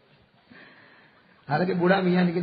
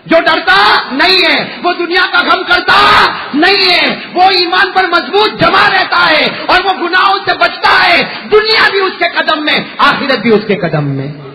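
loud, higher preaching at full force; fiery preaching; 7 semitones higher, 11 dB louder than usual. Acted. Preacher firing up the gathering about the saints, full-force preaching.

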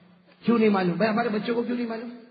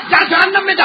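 higher, louder retold boss yelling; angry shout; 9 semitones higher, 9 dB louder than usual. Angry boss sending the uncle to hell, hammed-up shout. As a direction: bark the boss's curse as a hammed-up yell. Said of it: "बोलते बोलते आखिर में क्या बोला?".

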